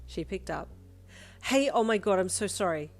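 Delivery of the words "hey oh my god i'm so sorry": loud, higher apologetic yell. A narrator's reading. Yin yelling her apology to Jiro on the phone. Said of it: "you yelled into the phone".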